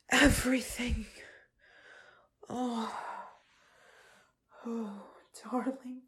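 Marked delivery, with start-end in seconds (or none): 2.5-6.0 soft breathless whisper. Acted. Lover in the afterglow, whispering softly. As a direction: barely voice it, all air.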